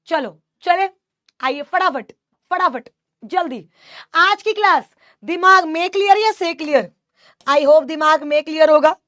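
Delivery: rally the students at the end of class; urging the class on. Real, not acted. Teacher hurrying class to answer.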